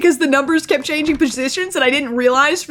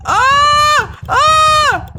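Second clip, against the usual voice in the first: panicked screams; 10 semitones higher, much slower than usual. Real, not acted. Player running from the killer, screaming high. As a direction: scream as you bolt for your life.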